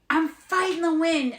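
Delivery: hammed-up angry guy voice; imitating the dude. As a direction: play him as defiant and worked up. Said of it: "And he's like".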